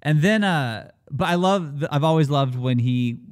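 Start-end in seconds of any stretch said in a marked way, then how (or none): none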